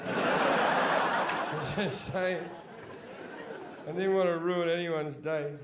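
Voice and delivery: very slow, breathless through laughter; laughing. Shown as a demonstration. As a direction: say it as you collapse laughing.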